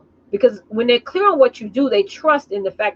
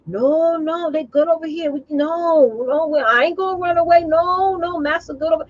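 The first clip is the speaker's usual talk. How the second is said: higher, pleading mock voice of a loyal house slave; 4 semitones higher than usual. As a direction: play a fawning house slave who defends the master, pitched up. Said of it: "she sounded to me like a house slave".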